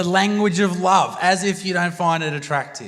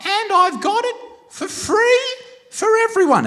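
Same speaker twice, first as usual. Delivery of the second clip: proclaiming woman; 14 semitones higher than usual. Acted. Woman promising a crowd a free cure, high proclaiming. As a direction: belt out a grand promise in a high voice.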